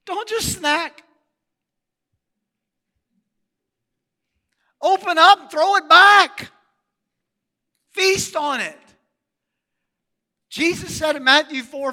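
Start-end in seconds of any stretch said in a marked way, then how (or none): 0.0-8.8 rising, pushed preacher call; full-force sermon exhortation; preaching. Acted. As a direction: urge the church to feast on the word.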